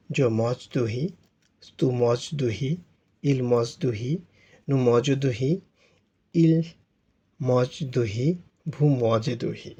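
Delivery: each French sentence said slowly and clearly for students to copy; modelled French pronunciation. Shown as a demonstration. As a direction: recite the drill line by line like a French teacher. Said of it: "তো উচ্চারণগুলো কি, কীভাবে হবে?". (ফরাসি উচ্চারণ ধীরে স্পষ্ট করে শেখানো)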